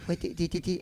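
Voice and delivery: high-pitched stammering impression; acquaintance impression. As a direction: imitate him stumbling over the first words, voice pushed up. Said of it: "this is how he talks".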